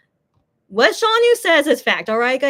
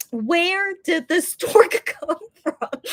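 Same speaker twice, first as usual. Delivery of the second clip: slow, higher, laughing through the question; laughing disbelief; 5 semitones higher, slower than usual. Retold or imitated. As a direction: ask it slowly while cracking up. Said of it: "I'm like".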